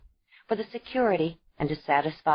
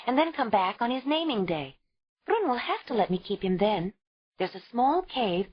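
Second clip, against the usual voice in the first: a bit higher, eager plan; lightly voiced young mother; 6 semitones higher than usual. A narrator's reading. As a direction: explain the idea urgently. Ayla explaining plan.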